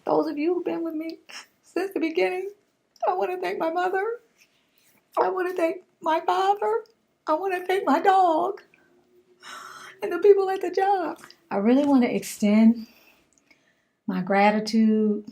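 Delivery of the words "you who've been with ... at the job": high, hammed-up award-winner gushing; mock acceptance speech. Thanking her viewers like an award winner, mock gushing. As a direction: thank everyone like you just won an Oscar.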